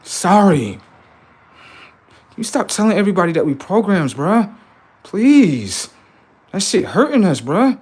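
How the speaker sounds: high, loud whiny begging voice; mock pleading AI. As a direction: plead like a scared program caught out.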